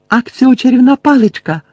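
high, loud urgent spell cry; крик. A narrator's reading. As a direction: shout the summoning spell out high. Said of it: "крикнула Герміона". (крик, вигукнуте закляття)

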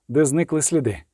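ordinary delivery, nothing marked

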